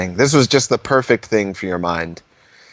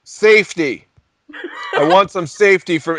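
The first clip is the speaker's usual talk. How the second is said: mock DJ ranting; 8 semitones higher, 4 dB louder than usual. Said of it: "being like".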